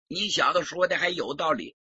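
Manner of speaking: ordinary delivery, nothing marked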